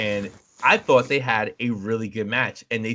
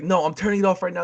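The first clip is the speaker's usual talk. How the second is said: raised-pitch mock scolding; imitated strict mom; 8 semitones higher, faster than usual. Mom refusing her gaming kid, mock stern. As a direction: lay down the law as a fed-up parent. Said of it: "she was like".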